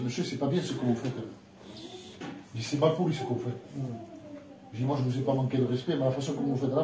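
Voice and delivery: soft, low and gentle; calm reply. Retold or imitated. Preacher answering the aggressive neighbour, quiet and calm. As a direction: keep it hushed and calm against his anger.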